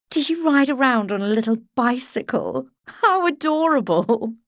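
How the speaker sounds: high, sing-song mock delight; patronising coo; condescending socialite. A narrator's reading. Portia mocks the nurse.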